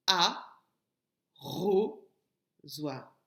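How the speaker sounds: "a, ro, zoir" with long gaps between syllables. Shown as a demonstration. Teacher locating ro for pupils, "a, ro, zoir" in separate beats. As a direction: set each of "a, ro, zoir" apart, dropping on the last. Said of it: "la syllabe ro se trouve".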